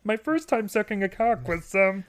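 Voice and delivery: high, far Kermit voice; squeaky frog puppet voice. Retold or imitated. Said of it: "That was my Kermit the Frog impression".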